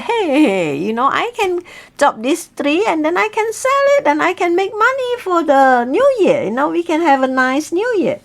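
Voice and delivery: cheerful husband. Acted. Happy husband with axe.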